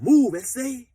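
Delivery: high, loud hard-edged command; tough gangster voice. Retold or imitated. Prison yard gangster telling him to move, tough voice. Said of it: "Chill be doing the voices".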